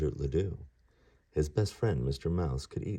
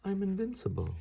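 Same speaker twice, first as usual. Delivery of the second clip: put-on much higher proud kid voice; cocky boast. Ganesha boasting to Mouse, a high cocky voice. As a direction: crow that nothing can hurt you. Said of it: "said Ganesha".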